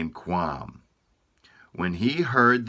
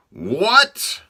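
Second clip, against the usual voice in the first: loud, much higher roar of disbelief; roaring king; 13 semitones higher, 9 dB louder than usual. Acted. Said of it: "roared the king".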